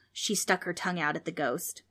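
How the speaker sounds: usual speaking voice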